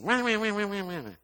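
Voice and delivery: high-pitched wah-wah mimicking a complainer; mocking whiny sister. Imitating sister's complaints about her husband, mocking high whine. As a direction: drone out high, mocking wahs. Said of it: "That's exactly how she sounded".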